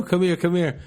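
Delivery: eager beckoning. Retold or imitated. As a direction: beckon someone over, mock urgent. Retold beckoning after the show.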